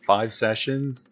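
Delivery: ordinary delivery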